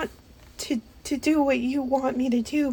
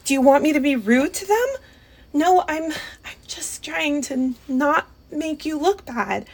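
ordinary talk, nothing marked

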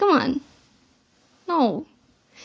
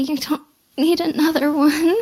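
ordinary delivery

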